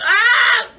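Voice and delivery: high loud cry of disgust; disgusted wail. Real, not acted. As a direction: cry out after swallowing. After swallowing the food.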